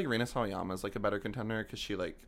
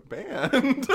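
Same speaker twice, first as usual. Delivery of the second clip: high laughing disbelief; incredulous laugh; 11 dB louder than usual. Retold or imitated. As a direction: squeak it out in disbelief.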